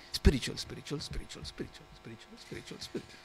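mock chant that fades out; fading to a whisper. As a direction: repeat the word, dying away into a whisper. Mocking repetition of the overused word.

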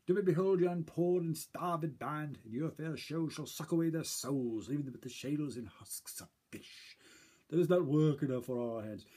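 slow, grand, loud stage speech; mock Shakespearean declamation. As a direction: declaim like a haughty French noble. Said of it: "And I would say".

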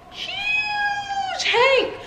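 high squealed praise; hyping up Hank, high; excited squeal. Real, not acted. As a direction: squeal the dog's name with glee. Shouting at dog playfully.

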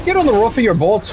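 high, loud put-on Scottish-sounding accent; exaggerated accent drifting into Scottish; accent that sounds Scottish. Shown as a demonstration. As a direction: call it out in a Scottish-leaning Canadian accent. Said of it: "that one fucking sounded Scottish".

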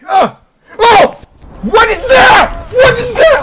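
shocked scream. Real, not acted. Streamer screaming in shock at a new obstacle.

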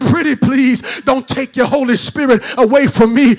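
chanted, whooping sermon cadence; shout-sing the sermon over the music; preaching at full force. Acted. Preacher whooping to the congregation, full force.

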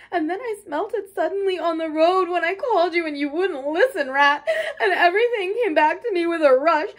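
high, loud tearful wailing; sobbing brokenly. A narrator's reading. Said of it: "he sobbed forth at last brokenly".

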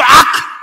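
loud, high mock choking shout; choked yelp. Boy choking again in fervent prayer, loud yelp. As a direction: burst out with an abrupt, throat-caught cry. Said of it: "목에 걸리는 건".

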